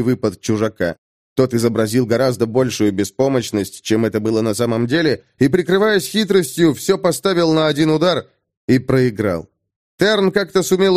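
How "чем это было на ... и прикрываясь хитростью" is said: ordinary delivery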